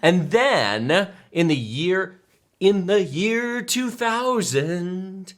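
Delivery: very slow, hammed-up epic pacing; dramatic slow announcement. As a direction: stretch each word out slowly, mock grand.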